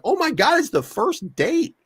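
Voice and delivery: high, incredulous oh my God; mock disbelief. He reacts to a TV dating show in mock disbelief. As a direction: gasp it out in disbelief. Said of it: "I'm like".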